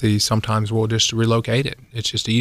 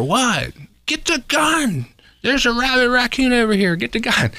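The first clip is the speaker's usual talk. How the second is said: much higher mock shout, urgent; imitated hollering; 12 semitones higher than usual. Dad hollers at the son.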